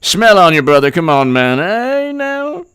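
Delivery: hammed-up speech parody, high and loud; Biden. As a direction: holler it like a mock convention speaker. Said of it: "I'll give you his speech".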